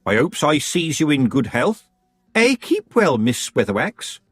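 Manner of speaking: high, sugary-polite rival witch; sweet on top, a knife underneath; Missus Earwig. Acted. Earwig's pointed greeting.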